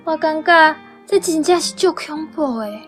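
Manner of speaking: ordinary delivery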